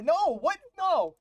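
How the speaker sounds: high, loud disbelief; shocked protest, voice jumping up. Real, not acted. Shocked at losing verdict.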